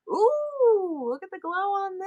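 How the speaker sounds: high, thrilled "ooh" at the glowing glass; excited delight. Real, not acted. Admiring a glowing glass piece.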